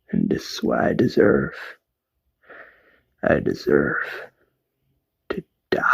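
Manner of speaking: whispered, breaking last words; dying whisper. Retold or imitated. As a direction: barely breathe it out like a dying father. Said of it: "his last words are".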